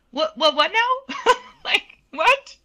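high, loud, laughing disbelief; can't believe what she's hearing; stunned disbelief. Retold or imitated. Told she will sing the performance, squealing in disbelief.

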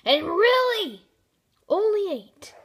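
loud, high exasperated complaint. Real, not acted. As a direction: yell out the score in disbelief. Complaining about low score.

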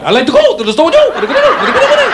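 very high, squealing jumbled patter; hammed-up, very high-pitched Filipino gibberish; high part of fast Filipino. Shown as a demonstration. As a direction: shoot way up high and jumble the words. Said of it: "Then you put the high part".

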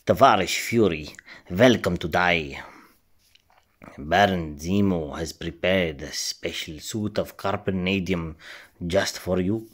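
put-on Russian-accented villain greeting Fury. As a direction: ham up a Russian henchman. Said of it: "I was trying to do a different accent".

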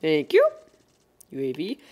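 ordinary talk

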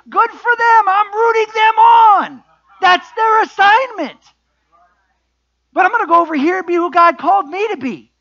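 high, loud shouted preaching; full-force preaching. Real, not acted. Preacher answers comparisons with other churches, full-force preaching.

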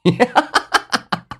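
high, rattling peal of laughter; long crazed high laugh; wild laughter. A narrator's reading. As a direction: laugh on and on like a madman. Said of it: "洪德利的大笑声".

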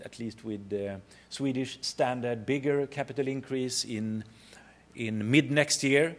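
normal talking voice

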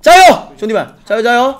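loud call of 加油 to the fans; rallying cheer. Real, not acted. Streamer rallies his viewers after a tough loss, loud cheer. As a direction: pump up the viewers with a shout.

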